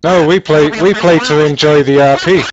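high, loud angry scolding; heated telling-off. Real, not acted. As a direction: lay into him, raising your voice. Heated argument with angry player.

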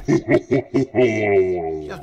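long loud drawn-out cry; terrified cry. Real, not acted. Player crying out at a scare, long loud terrified cry. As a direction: cry out in dread.

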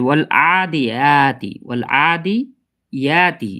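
drawn-out আদিয়াতি with long আ and ইয়া, final তি sounded; careful recitation. Shown as a demonstration. Teacher showing where most readers go wrong, careful recitation. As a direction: recite আদিয়াতি slowly, stretching ইয়া before a clear তি. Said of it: "সেটা হলো এখানে ইয়াতে".

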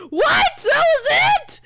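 high, loud, incredulous outcry; loud disbelief. Real, not acted. Gamer finds the game over already, incredulous shout. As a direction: shout it in disbelief, drawing out the end.

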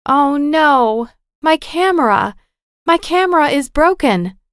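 high, dismayed outcry; acted upset, pitched well up; distressed exclamation. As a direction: cry out in alarm at the damage. Discovers her expensive camera is broken.

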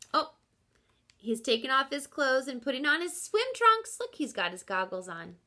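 usual speaking voice

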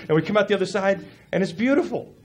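normal talking voice

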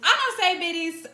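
indignant protest; defensive denial. Real, not acted. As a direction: push back hard. Denying being accused.

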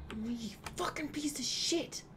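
a sharp, hushed swear under the breath; whispered curse. Real, not acted. Whispering a curse at the game after a fail. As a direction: curse the game without being heard.

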